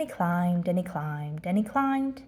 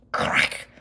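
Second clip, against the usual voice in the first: snap sound. A narrator's reading. Branch snaps.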